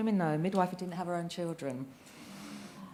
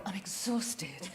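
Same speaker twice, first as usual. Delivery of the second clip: mock weary sign-off; 9 dB quieter, much slower than usual. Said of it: "I end it with".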